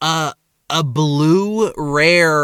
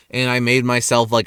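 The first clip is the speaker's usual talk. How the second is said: normal talking voice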